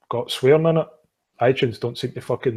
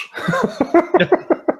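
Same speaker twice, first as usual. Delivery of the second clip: high laugh; 4 dB louder than usual. Real, not acted. Host cracks up at the joke.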